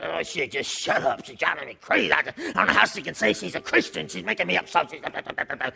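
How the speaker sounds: low, soft grumble trailing into blah-blah; grumbling husband. Acted. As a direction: grouse under your breath like a fed-up driver. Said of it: "You're like".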